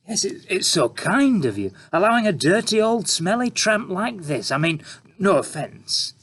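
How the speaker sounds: oozing fake praise; sarcastic reporter. A narrator's reading. Reporter character.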